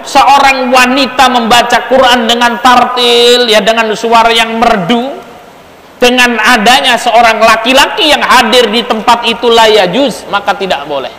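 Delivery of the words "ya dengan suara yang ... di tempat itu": ordinary delivery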